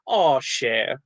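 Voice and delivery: New Orleans endearment, at an easy pitch; New Orleans catchphrase. Retold or imitated. Actor quoting locals' pet phrase, New Orleans accent. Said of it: "something they say where they go".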